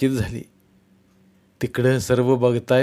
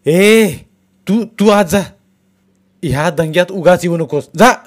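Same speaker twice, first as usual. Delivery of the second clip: loud, high, furious yell; angry shout; 6 semitones higher, 8 dB louder than usual. A narrator's reading. Old man shouting angrily at his wife to go back. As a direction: bark the old woman back inside. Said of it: "म्हातारा तिच्यावर खवाळला".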